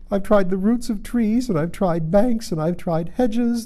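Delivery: high-voiced, exasperated bird; fretful pigeon. Pigeon complains of the hiding places tried, fretful. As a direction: list your woes in a high pigeon voice.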